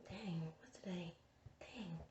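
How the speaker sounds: breathy whisper to herself. Real, not acted. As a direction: whisper it under your breath. Whispers to herself while filming.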